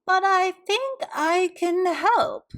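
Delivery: put-on high, slow, bright little old lady; cheerful old lady voice; bright old woman. A narrator's reading. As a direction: offer help sweetly. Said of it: "she said brightly".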